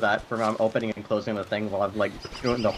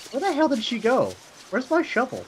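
squeaky confused complaining; high-pitched whiny voice; 12 semitones higher than usual. Retold or imitated. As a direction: pitch way up, puzzled and hurt. Voicing his own character.

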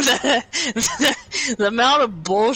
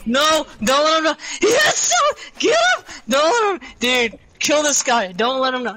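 urgent excited yelling; 6 semitones higher than usual. Real, not acted. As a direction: shout at teammate to finish him. Urging teammate to kill.

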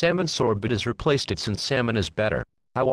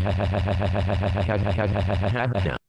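long, droning stuttered noise; monotonous machine-gun "ha-ha-ha"; imitated noise; 3 semitones lower than usual. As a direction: rattle off one syllable mechanically, on and on. Noise of the piano ride.

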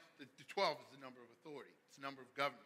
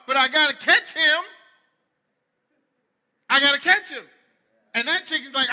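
loud, high, fired-up preaching; loud animated sermon; full-force preaching; 10 semitones higher, 20 dB louder than usual. Acted. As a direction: preach it big and loud to the crowd.

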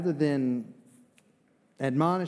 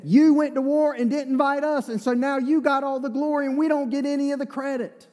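raised, loud grievance, pitched much higher; angry complaint; 10 semitones higher, 4 dB louder than usual. Acted. Ephraim's men confronting Gideon, angry and loud. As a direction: complain hotly about being left out. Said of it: "the men of Ephraim were angry".

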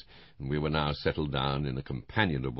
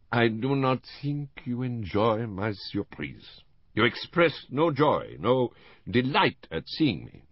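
slow, higher wounded-dignity Poirot; a hint of a hurt Belgian detective; lightly acted Poirot, mock offended; lightly voiced Poirot; 8 semitones higher, 4 dB louder, slower than usual. Poirot sulks at cold welcome.

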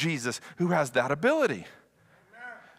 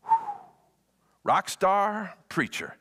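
ordinary talk

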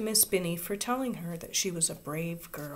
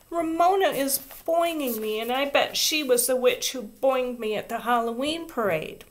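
high, louder whiny complaint; tattling near tears; 5 semitones higher, 4 dB louder than usual. A narrator's reading. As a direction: tell on her, close to crying. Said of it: "cried Susan on the verge of tears".

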